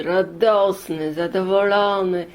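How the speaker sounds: plain reading voice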